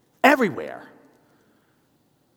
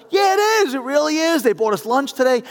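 very high, loud imitated enthusiasm; gushing receptionist; 12 semitones higher, 7 dB louder than usual. Receptionist answering the caller's question, mock gushing. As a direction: gush it, pitched way up. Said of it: "I get some answers that are".

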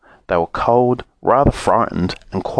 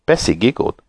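usual speaking voice

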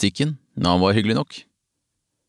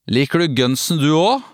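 lightly acted slurring, higher pitch, rising question; slurred; 6 semitones higher than usual. A narrator's reading. Girl on bench quizzes stranger about band, lightly slurred. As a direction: slur it like a tipsy girl, lightly. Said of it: "snøvlet hun".

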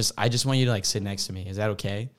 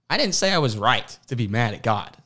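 emphatic retort; 2 semitones higher, 5 dB louder than usual. Retold or imitated. Retort to critics, emphatic defence.

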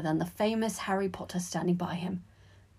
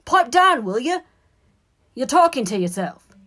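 loud, raised scolding; annoyed telling-off; 6 semitones higher, 10 dB louder than usual. A narrator's reading. Sixth year scolding the boy talking to himself, annoyed. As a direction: order him sharply to shut up. Banana thrower tells boy off.

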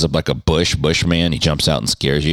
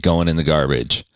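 ordinary talk

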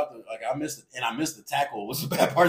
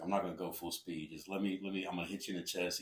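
hushed, much softer whisper-talk in a stance; whispering teammate; 8 semitones lower, 15 dB quieter than usual. Retold or imitated. Tackle hushes his deal to me in a low voice. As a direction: keep it low so the coaches can't hear. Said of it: "whispering at each other".

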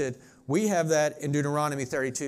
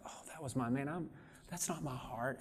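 much softer, under the voice; hushed imagined aside; 12 dB quieter than usual. Acted. Listener hearing himself in the preacher's example, hushed aside. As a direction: say it quietly, as if to yourself.

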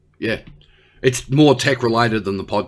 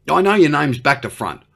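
usual speaking voice

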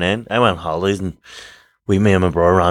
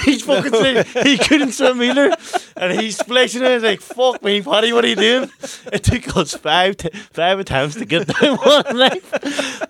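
high-pitched, real helpless laughter; squeaking the story out through laughter; laughing while telling; slower than usual. Real, not acted. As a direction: crack up as you tell it. Cracking up telling pool fiasco.

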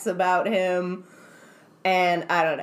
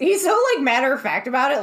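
excited; 5 semitones higher, 5 dB louder, faster than usual. Real, not acted. Excited description.

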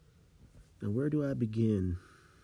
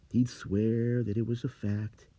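usual speaking voice

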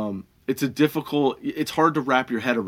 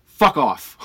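retold dismissal; 6 dB louder than usual. Telling rude customer to leave.

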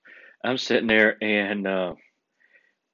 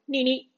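high two-beat horn honk; imitated car horn. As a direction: mimic a car horn beeping twice. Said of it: "We hear a horn".

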